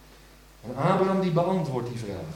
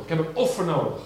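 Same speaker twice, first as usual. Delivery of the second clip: ordinary delivery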